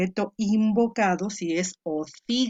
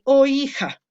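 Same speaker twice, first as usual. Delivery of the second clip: "Oh hija" called out louder and higher, "Oh" stressed; exclaimed oh hija; 7 semitones higher, 7 dB louder than usual. Shown as a demonstration. Teacher reading out a Greek line for students, calling out. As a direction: read "Oh hija" as a real call to a daughter. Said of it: "aquí dice".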